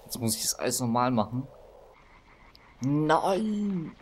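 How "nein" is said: high cry into laughter; dismayed cry. Real, not acted. Dies again, cry and laugh.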